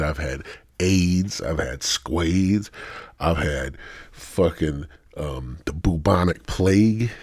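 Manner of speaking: normal talking voice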